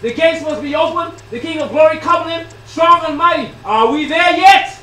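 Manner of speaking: loud, high, ringing exhortation; full-force preaching. Real, not acted. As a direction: call out to wake the congregation.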